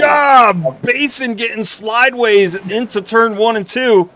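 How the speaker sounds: high, loud excited commentary; excited call. Real, not acted. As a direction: call the slide as it happens.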